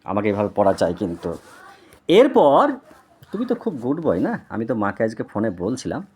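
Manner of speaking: usual speaking voice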